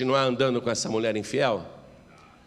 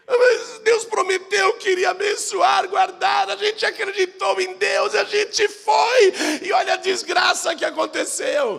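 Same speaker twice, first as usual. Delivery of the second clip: anguished shout; 4 dB louder than usual. Acted. The people in the camp blaming God, shouting in grief. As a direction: howl the accusation at heaven.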